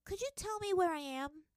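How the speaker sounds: put-on high, sweet little-girl question; girl sweetly asking merchants directions; sweet innocent asking. A narrator's reading. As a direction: play the lost innocent child. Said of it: "Toph called sweetly".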